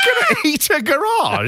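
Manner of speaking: high-pitched disbelieving repeat; mock incredulity. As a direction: squeal it back, baffled and amused. Repeats wrestler's odd line incredulously.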